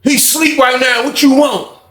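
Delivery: high, slow mocking tone; menacing answer. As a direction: brush him off from behind the door. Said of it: "He was like".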